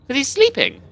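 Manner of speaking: high, sharp burst of disbelief; answering your own dumb question, exasperated; exasperated outburst. Real, not acted. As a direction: blurt it out, high and incredulous.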